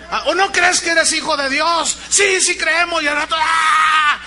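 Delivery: high, loud goading; mock hype shout. Preacher parodying a hyped congregation, shouting. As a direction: shout like a manipulative pastor. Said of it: "Meter la manipulación emocional".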